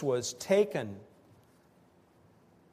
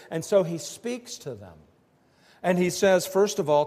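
ordinary talk

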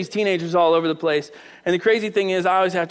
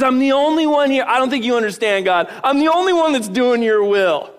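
raised, loud, insistent complaint; indignant protest; 5 semitones higher, 5 dB louder than usual. Acted. Elijah insisting to God he's alone, indignant. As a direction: argue your case, pushing the key words.

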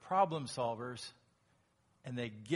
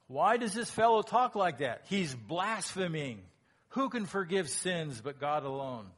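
higher, louder scandalized questioning; indignant scribes; 3 semitones higher, 6 dB louder than usual. Acted. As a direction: sound like an offended scholar.

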